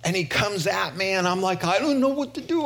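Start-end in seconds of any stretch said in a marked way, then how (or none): 1.6-2.6 high, hurried mock panic; flustered disciple. Disciple telling Jesus about a demoniac, flustered. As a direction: play a rattled disciple, talking fast and high.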